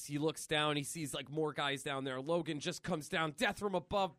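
ordinary talk, nothing marked

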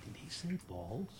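very soft, disbelieving question; hushed aside. Retold or imitated. Actor asking a colleague in shock, hushed. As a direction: whisper it to a neighbor in disbelief. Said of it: "I turned to someone and said".

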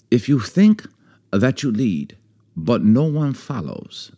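a lightly put-on Chinese accent, slow; mock proverb-sage voice, barely changed; bad Chinese accent. Wife's podcast cohost hears a bad Chinese accent. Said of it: "I do terrible accents".

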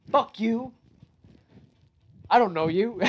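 retold indignant outburst; defiant comeback. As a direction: throw it back at him.

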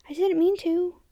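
plain reading voice

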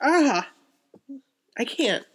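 high, overcome, unable to go on; choked up. Real, not acted. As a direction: give up reading, close to tears. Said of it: "I'm gonna cry".

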